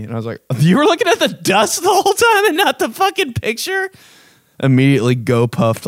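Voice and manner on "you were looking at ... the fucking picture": much higher, squeaky disbelief; incredulous. Retold or imitated. To the girl who stared at the dust, high incredulous squeal. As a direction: screech it in total disbelief.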